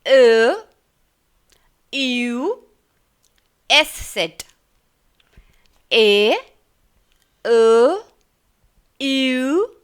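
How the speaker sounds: ए, ए and यू said loud and drawn out as German umlauts; teacher calling out umlaut letters; German umlaut sounds. Shown as a demonstration. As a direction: sound out each umlaut clearly. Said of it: "जो हैं कि ए".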